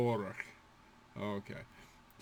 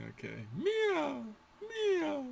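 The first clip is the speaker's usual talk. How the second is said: slow whiny cat meow; high complaining meow; slower than usual. Shown as a demonstration. Teacher meowing like a complaining cat, high.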